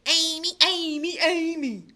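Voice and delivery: loud, taunting repetition of the name; mocking singsong. A boy complains to his friend about the new girl, mocking singsong. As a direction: sneer her name over and over.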